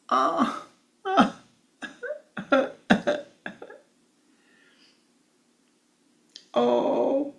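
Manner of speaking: high, loud hammed-up wailing sobs; mock crying. Child heard crying in the forest, mock wailing. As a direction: wail "ah, oh" in bursts, high and pretend. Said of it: "for the crying had gotten louder".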